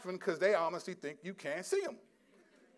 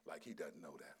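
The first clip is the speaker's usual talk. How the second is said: much softer, lower dry aside; wry aside; 10 semitones lower, 15 dB quieter than usual. Real, not acted. Preacher undercutting the scripture with a quiet wry aside. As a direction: drop to a quiet knowing aside.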